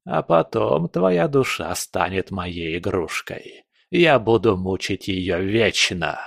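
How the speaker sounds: slow, relishing villain; menacing old spirit. Acted. Spirit promises captive eternal torment, slow menace. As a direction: savor the threat, unhurried.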